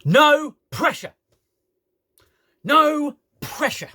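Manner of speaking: PRESSURE said in full, both syllables, high and loud; exasperated, slow demonstration of PRESSURE to its end; full word. Shown as a demonstration. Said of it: "to finish the fucking word".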